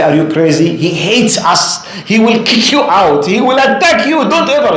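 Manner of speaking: alarmed warning. Retold or imitated. Friends warn the brother not to visit the neighbor, alarmed.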